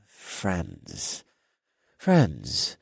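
put-on low, fading drunken mumble; trailing drunk. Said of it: "I trailed off as I feigned drunkenness".

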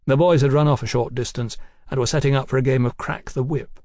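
plain reading voice

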